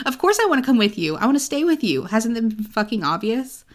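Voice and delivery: fast, high, loud declaration; pleading outburst. Retold or imitated. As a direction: pour it all out in a rush. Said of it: "he's like".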